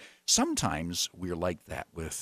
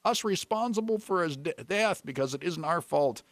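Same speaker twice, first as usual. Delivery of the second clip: pleading; 7 semitones higher than usual. A narrator's reading. Sailors begging the Lord to spare them, pleading. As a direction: beg in a high voice, gradually settling. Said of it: "they pleaded".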